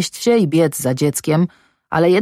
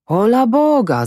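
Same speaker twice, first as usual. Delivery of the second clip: higher-pitched exclamation of dismay; called-out exclamation; 7 semitones higher than usual. A narrator's reading. Guard sees the bodies on the sledge, exclaiming. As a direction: call it out on a raised pitch. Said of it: "zawołał jeden".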